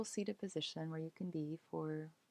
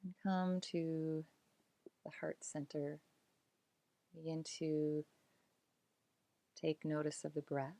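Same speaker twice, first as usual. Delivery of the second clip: calm meditation voice with long pauses; unhurried, soothing body-scan narration, letting phrases settle; guided relaxation. Acted. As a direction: guide them gently into release, phrase by phrase.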